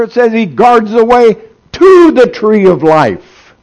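high, loud preaching at full force; fiery preaching. Acted.

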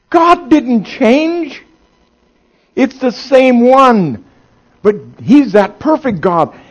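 high, loud preaching at full force; fiery preaching. Acted.

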